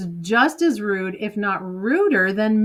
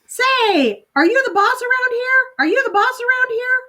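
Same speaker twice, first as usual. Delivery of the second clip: very high, squawking bird voice, cheeky challenge; a bossy parrot taunting the mother; high-pitched parrot jeer, repeated; yelling parrot; 4 dB louder than usual. Acted. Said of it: "blinking and yelling".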